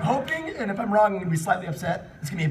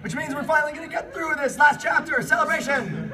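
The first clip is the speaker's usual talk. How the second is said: giddy celebration; excited celebration; 8 semitones higher, 3 dB louder than usual. Real, not acted. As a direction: pitch jumps up, half shouting. Celebrating reaching last chapter.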